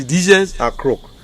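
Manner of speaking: ordinary talk, nothing marked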